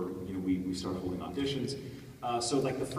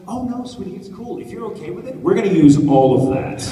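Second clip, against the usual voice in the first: higher, louder mock-sweet director voice; imitating a cheerful director, pitched up and loud; sweet reassuring director; 10 semitones higher, 18 dB louder than usual. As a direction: play the gushing director soothing her actor. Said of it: "She's like".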